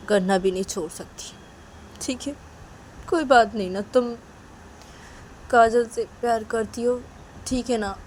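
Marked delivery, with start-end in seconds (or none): none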